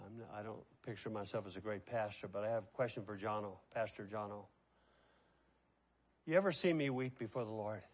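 soft, fast, hushed admission; quiet confession. Real, not acted. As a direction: drop the voice to confess to the pastor. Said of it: "I'm not a good father".

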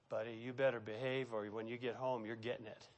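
a mother's silent threat, spoken low; mother's warning look voiced. Mother warning her son in church, hushed. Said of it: "she would go".

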